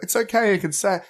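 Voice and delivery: imitated mum's high-pitched encouragement; reassuring mum. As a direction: coax a nervous child, lightly acted. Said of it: "encourage him 'cause he was so nervous".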